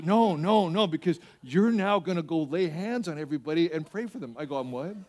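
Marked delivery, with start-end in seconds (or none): none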